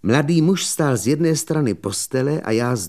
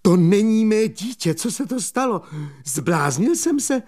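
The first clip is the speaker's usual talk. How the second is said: zděšení; 10 semitones higher than usual. Acted. Mladík zděšen nad košíkem.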